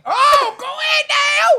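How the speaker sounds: loud, slow, stretched cheer; excited shout. Real, not acted. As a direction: hype her up, loud and drawn out. Cheering the host on.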